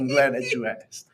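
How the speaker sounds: a laugh running through the words; amused, half laughing. Real, not acted. Laughing reply to staged question.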